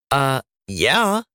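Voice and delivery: dripping sarcasm, talking down; condescending sarcasm, drawn out; sarcastic condescending. Acted. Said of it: "in her most sarcastic and condescending voice".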